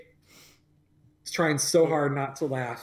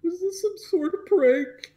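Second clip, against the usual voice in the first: put-on suspicious kid; 13 semitones higher than usual. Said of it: "he's just like".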